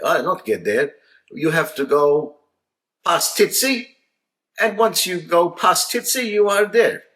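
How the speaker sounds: high, loud Maltese punchline; Maltese accent. Retold or imitated. Maltese dad springing the joke on the Australian, high and loud. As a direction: deliver the punchline triumphantly. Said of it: "He says".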